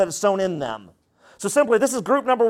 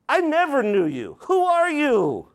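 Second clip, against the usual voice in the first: raised-pitch, firm dismissal; emphatic rejection; 6 semitones higher than usual. Acted. Jesus turning away false followers, emphatic rejection. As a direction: pronounce it as a final judgment. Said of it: "This is Jesus talking".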